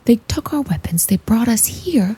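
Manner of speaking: hushed unvoiced whisper; stealth whisper. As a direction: whisper it so the guards miss it. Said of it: "stealth whisper".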